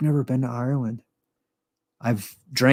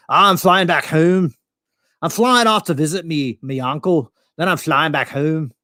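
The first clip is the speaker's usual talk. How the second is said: higher mock Scottish brogue; 4 semitones higher than usual. Pretend Scot tells American seatmate his plans, Scottish brogue. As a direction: put on a chatty Scottish accent. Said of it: "I could do a pretty good Scottish brogue".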